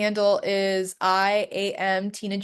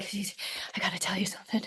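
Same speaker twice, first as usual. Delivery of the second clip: whispered confession; 11 dB quieter, faster than usual. Retold or imitated. Dad on the phone at midnight whispering to his daughter. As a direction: whisper it, barely voiced and soft. Dad's eerie late-night confession.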